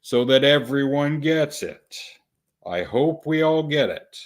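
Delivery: pointedly slow explaining; slow, deliberate. Real, not acted. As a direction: say it slowly so everyone gets it. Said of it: "say this slowly".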